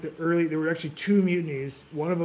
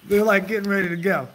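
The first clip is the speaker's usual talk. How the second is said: laughing; 5 semitones higher, 6 dB louder than usual. Real, not acted. Laughs while telling the story.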